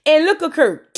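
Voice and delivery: ordinary talk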